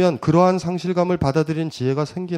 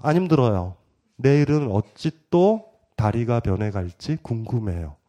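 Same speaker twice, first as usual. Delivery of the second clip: calm reply; 6 semitones lower, 3 dB quieter than usual. Retold or imitated. Disfigured man answering the child, calm and curious. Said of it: "이 얘기를 한단 말이에요".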